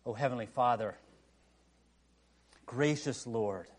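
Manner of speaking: slow reverent prayer voice; hushed pastoral prayer. Acted. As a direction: pray over the congregation slowly. Leads closing prayer.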